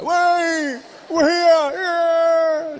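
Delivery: high, drawn-out whooping; imitated fan cheer. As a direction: whoop like an excited crowd at the back. Imitates audience member cheering from back.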